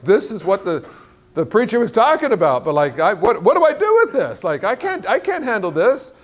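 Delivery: high, flustered comic protest; mock panic. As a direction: act out panicking, pitch leaping up. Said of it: "he's just panicking".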